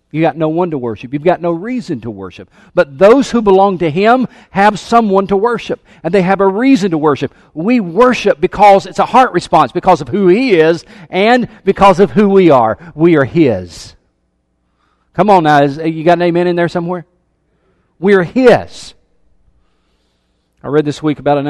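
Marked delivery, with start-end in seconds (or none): 2.7-13.9 loud, driving preacher's voice; fervent sermon delivery; preaching at full force. Acted. Preaching to congregation. 15.1-18.9 loud, rousing preacher's call; loud call for an amen from the church. Acted. Calling for amen.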